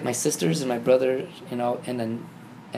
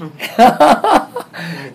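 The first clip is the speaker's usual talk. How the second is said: loud, high burst of laughter; real delighted laughing out loud; hearty laugh; 10 semitones higher, 13 dB louder than usual. Real, not acted. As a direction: crack up at your own punchline. Laughs at own punchline.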